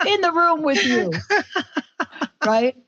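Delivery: put-on high, broad New York accent. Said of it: "I picked up your New York accent".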